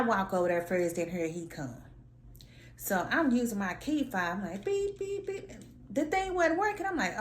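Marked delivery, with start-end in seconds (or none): 4.6-5.5 high mock beeping; squeaky imitated beeps; fob beeps. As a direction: imitate the key fob's beep.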